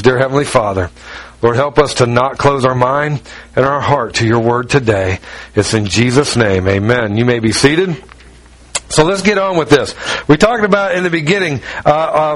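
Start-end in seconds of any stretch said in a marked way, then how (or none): 0.0-7.2 low, earnest pulpit prayer; pastor's opening prayer, low and sincere. Real, not acted. Pastor prays before sermon.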